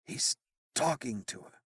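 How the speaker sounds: soft, hushed murmur; barely audible. A narrator's reading. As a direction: speak almost under the breath. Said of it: "in a voice barely audible".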